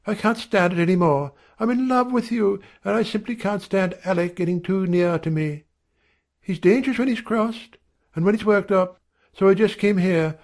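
high, insistent woman's outpouring; high-pitched volley of confession; rapid-fire outburst. A narrator's reading. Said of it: "as suddenly as a machine gun leaves off firing".

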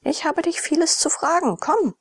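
high-pitched, insistent lady; insist, leaving no room for refusal; brooking no contradiction. A narrator's reading. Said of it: "in einem Tone, der keinen Widerspruch zu dulden schien".

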